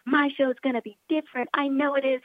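high-pitched mock boasting; imitating everyone else. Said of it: "Like, everyone says".

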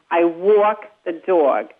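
slow Cockney English accent, rounded vowels. Shown as a demonstration. A coach contrasts New York with Cockney English. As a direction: drag it out Cockney style. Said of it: "So you go from ... to".

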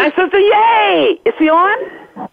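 high-pitched, drawn-out delight; thrilled squeal of welcome; excited. Real, not acted. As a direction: cheer slowly and high for your sister. Sister surprises her by calling in.

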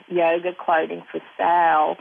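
ordinary talk, nothing marked

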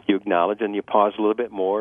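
ordinary talk

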